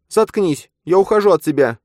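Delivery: high, loud, ухожу punched; furious shout. Acted. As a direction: yell it, slamming ухожу. Nastya leaving him.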